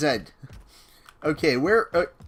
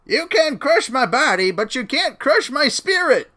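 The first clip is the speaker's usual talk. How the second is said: mock heroic; 7 semitones higher, 5 dB louder than usual. Defiant game declaration.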